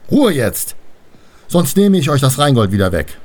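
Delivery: loud, forceful scolding; burst out. A narrator's reading. Vater Rhein threatens his daughters, bursting out loudly. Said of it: "stieß Vater Rhein hervor".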